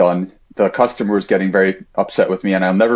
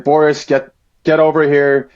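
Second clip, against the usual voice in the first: higher, louder yell, retelling the customer; retold angry yell; 5 semitones higher, 3 dB louder than usual. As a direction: call the kid over, furious. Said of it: "he absolutely lost it".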